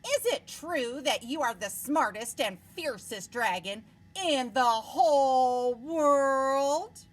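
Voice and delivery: butter up the dragon; sweet flattering princess. Acted. Princess flattering the dragon to trick him, sweetly.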